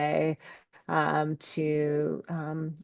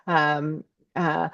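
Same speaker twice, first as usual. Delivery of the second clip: ordinary talk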